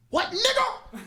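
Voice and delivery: put-on high, challenging kid voice; kid squaring up. Nephew on stage to the classmate, high and challenging. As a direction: pitch it way up, ready to fight.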